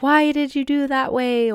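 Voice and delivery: high, scolding voice of the inner critic; harsh inner critic. Retold or imitated. Inner critic scolding you like a harsh coach. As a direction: nag yourself like a harsh coach. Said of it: "inner critic is like that super harsh coach".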